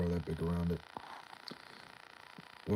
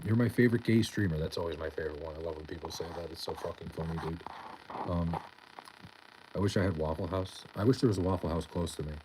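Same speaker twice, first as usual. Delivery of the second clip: usual speaking voice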